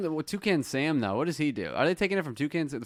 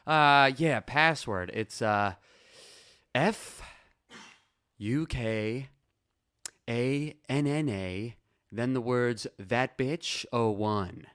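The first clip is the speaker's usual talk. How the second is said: halting, with long pauses between letters; frozen, hesitant; much slower than usual. Acted. Dad giving his password to the teller in front of his child, frozen and halting. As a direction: spell it out reluctantly, a bit at a time. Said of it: "he kinda froze and was like".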